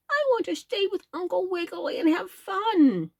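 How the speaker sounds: very high whiny plea; whining elephant. A narrator's reading. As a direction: whine like a sulky child. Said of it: "whined the tremendous creature".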